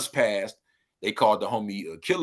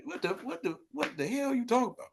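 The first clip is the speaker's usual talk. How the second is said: high, softer incredulous splutter; mock bafflement; 11 semitones higher, 8 dB quieter than usual. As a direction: splutter in high-pitched disbelief. Repeats the acted incredulous comeback.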